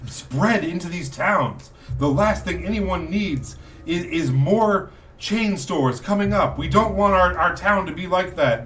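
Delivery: high, agitated rallying speech; indignant townsman. Acted. Townsman warning against chain stores coming up, indignant.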